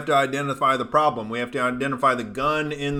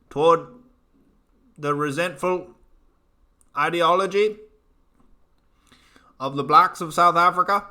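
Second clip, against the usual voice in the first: higher-pitched, put-on South African accent; mock South African accent; 4 semitones higher, 3 dB louder than usual. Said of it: "That was my best South African".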